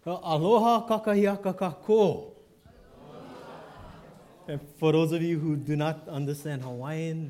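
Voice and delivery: ordinary delivery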